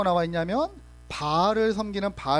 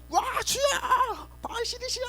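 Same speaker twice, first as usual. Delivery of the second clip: frantic shouting prayer. Retold or imitated. False prophets yelling at their god, high-pitched frenzied shout. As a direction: scream 바알 신이시여 at the sky in a high pitch. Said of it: "막 소리 지르면서 하고요".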